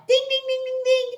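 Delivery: high sing-song ding ding; bell imitation. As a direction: ring like a little bell.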